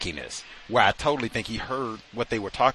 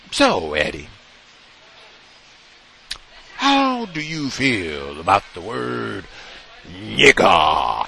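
drawn-out, leading question, the last word hit hard; imitated interviewer; slower than usual. Interviewer springing a question on Eddie, drawn-out and loaded. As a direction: stretch it slowly, then punch the final word. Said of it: "that's the way he said it too".

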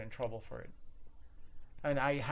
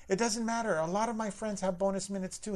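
defensive customer; 6 semitones higher, 3 dB louder than usual. Retold or imitated. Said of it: "he's like".

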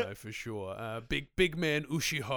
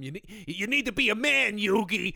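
Joey scolding; 6 semitones higher, 4 dB louder than usual. Retold or imitated. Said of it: "as Joey says to him".

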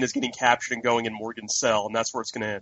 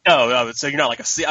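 ordinary talk, nothing marked

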